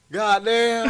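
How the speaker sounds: loud, high exclamation; retold exclamation. Storyteller reliving his shock to a friend, loud exclamation. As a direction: belt it out in amazement.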